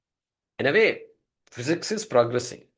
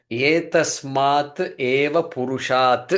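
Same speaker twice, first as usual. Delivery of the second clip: each syllable of Etasmat eva purushat drawn out very slowly; slow recitation; much slower than usual. Shown as a demonstration. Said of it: "let's do the moolam here".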